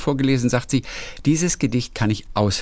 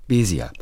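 "Besia" with BE stressed, falling away after; stress on first syllable; 3 semitones lower than usual. Shown as a demonstration. Reader demonstrating the old family surname, first-syllable stress. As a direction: lean on the opening syllable of "Besia". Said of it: "mit Betonung auf der ersten Silbe".

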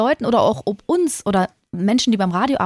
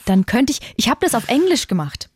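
usual speaking voice

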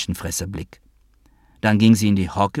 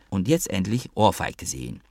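ordinary delivery

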